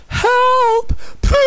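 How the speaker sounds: very high, loud shriek for help; shrieking, pleading cry; desperate scream. A narrator's reading. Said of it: "Desperate voices screamed".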